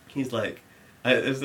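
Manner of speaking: ordinary delivery, nothing marked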